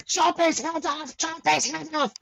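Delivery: very high toddler imitation; furious little boy. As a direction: mimic a stamping three-year-old's demand. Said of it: "it's like three years old".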